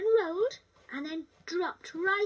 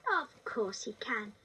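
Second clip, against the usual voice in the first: a father's voice, lower and slow; slow kindly dad reply, pitched down; 7 semitones lower, 3 dB quieter, slower than usual. Acted. Father tells his daughter the frog may stay, lower and slow.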